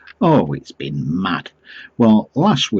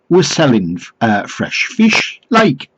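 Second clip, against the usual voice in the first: higher-pitched put-on Geordie; mock Geordie accent; 6 semitones higher, 5 dB louder, slower than usual. Doorstep caller offers fish to householder, mock Geordie. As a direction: make a sales pitch in dodgy Geordie. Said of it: "I don't think it was a genuine accent".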